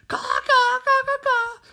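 loud, high crow squawks; crow cawing. Acted. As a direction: caw harshly like an attacking crow. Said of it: "A crow lunges for her".

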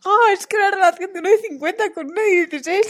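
imitating a shocked reaction; mock scandalized. Mimics their earlier scandalized reaction.

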